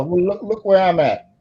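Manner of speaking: ordinary talk, nothing marked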